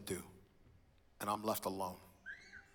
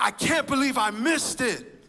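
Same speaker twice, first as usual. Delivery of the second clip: disbelieving outburst; 13 semitones higher, 9 dB louder than usual. Retold or imitated. Regrets missing the shootout.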